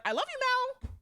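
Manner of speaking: high, sing-song "I love you"; playful love declaration. Retold or imitated. Teasing her friend Mal with a high mock love line. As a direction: gush it like a mock sweetheart. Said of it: "I would always be like".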